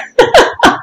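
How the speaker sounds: high tittering laugh; short, high laugh of delight; amused laugh. A narrator's reading. Lady laughing at a visitor's flattery, high and amused.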